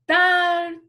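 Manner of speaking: "Antártida" with TÁR high, loud and held; stress on TÁR. Shown as a demonstration. Teacher counting to the strong syllable, stressed on TÁR. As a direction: punch TÁR hard, then separate TI and DA. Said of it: "A sílaba forte?".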